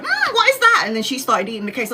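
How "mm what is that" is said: much higher, curious mum voice; imitating mum. Daughter mimics her mum spotting the cakes, high and curious. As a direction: pitch way up like a nosy mum. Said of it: "my mum was like".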